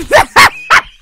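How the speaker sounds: very high, loud shriek of laughter; bursting laugh. Real, not acted. Laughing at own failed Scottish, shrieking laugh. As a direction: burst out laughing mid-attempt. Collapses after failed accent attempt.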